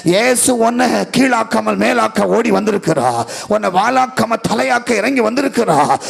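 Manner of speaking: a preacher pleading with the congregation to come forward, rising to a fervent cry; rising altar call. Real, not acted. Altar call builds to climax.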